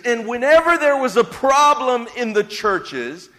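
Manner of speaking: preaching. Acted. Pastor preaching to the church at full force. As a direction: preach it to the back row.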